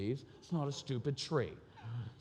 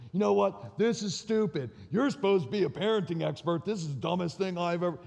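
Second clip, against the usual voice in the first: put-on angry kid; 4 semitones higher than usual. Son lashing out at his parenting-expert dad, mock angry. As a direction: imitate the son blowing up. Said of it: "They go from here to here".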